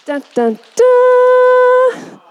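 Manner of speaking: mock drum roll, last dun high and loud; playful dun dun DUN. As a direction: hum a fanfare drum roll, big finish. Drum roll she asked for.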